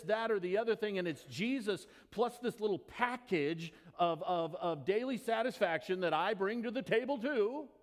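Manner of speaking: urgent preacher's appeal; preaching. Acted. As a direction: challenge the congregation. Preaching rebuke.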